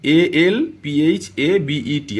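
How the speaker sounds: each letter of "alphabet" spelled slowly and distinctly; slow teacher spelling. Shown as a demonstration. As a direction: spell it slowly for the class, one letter at a time. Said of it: "বলে English alphabet".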